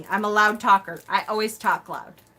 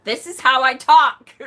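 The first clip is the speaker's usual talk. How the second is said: emphatic raised voice; loud talker; 3 semitones higher, 8 dB louder than usual. Retold or imitated. Loud talker explaining herself to mother, raised voice. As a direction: belt it out as proof of loudness. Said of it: "lower my voice because".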